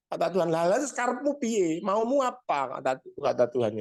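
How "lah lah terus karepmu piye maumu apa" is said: high, weary what-now reply; God answers the complaining man, exasperated. Acted. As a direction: ask him what he wants now.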